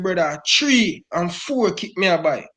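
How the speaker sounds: normal talking voice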